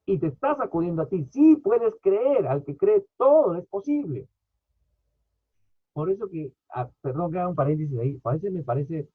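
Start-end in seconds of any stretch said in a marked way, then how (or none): 1.2-4.3 slow, high, louder preaching cry; preacher lifting the promise high; emphatic proclamation. Real, not acted.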